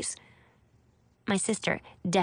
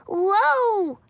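put-on high, loud little-girl yell; long high whoa, called out in alarm; crying out; 13 semitones higher, 6 dB louder than usual. A narrator's reading. Little girl clinging on as her pony stumbles, crying out. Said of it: "she cries".

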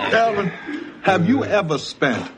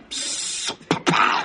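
explosion noise; 3 dB quieter than usual. Retold or imitated. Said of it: "take the lid off a pressure cooker".

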